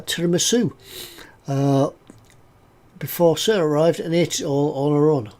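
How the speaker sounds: ordinary talk, nothing marked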